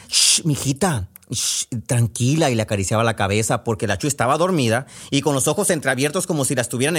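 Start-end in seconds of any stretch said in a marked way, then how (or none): 0.1-2.5 slow, gentle hushing; calm her back to sleep; soothing mother. Acted. Mother stroking her sleeping daughter's head, slow soothing hush.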